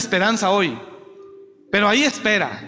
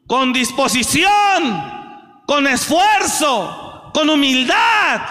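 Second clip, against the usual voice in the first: shouted, high, rising sermon cry; fiery preacher exhorting the congregation, shouting; preaching at full force; 9 semitones higher, 4 dB louder than usual. Acted. Preaching climax.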